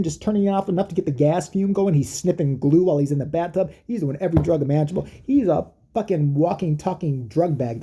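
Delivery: ordinary talk, nothing marked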